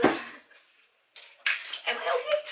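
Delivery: very high, drawn-out wobble; panicky self-check. Real, not acted. Checking herself mid-haircut, high panicky voice. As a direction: squeeze it out high and slow.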